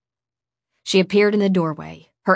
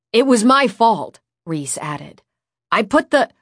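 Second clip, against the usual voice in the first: high, loud, insistent admission; urgent confession; 5 semitones higher, 6 dB louder than usual. A narrator's reading. Boy takes the blame before furious woman, urgent. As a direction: jump in to take the blame.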